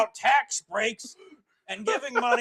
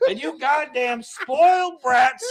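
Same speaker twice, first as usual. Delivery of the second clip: put-on slow, furious old man yell; angry boomer yell; 3 semitones higher, slower than usual. Boomer yelling at youth.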